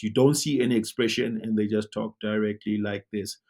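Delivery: ordinary talk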